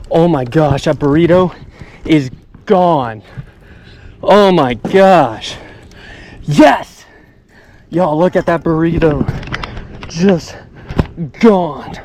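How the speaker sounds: yelling with pure joy at the catch; ecstatic. Real, not acted. Angler shouting over his record bass, real excitement. Landing personal best bass.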